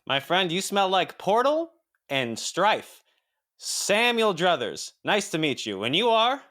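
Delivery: high, slow grand lawyer. Acted. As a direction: greet him high and slow. Samuel Druthers greets Korak.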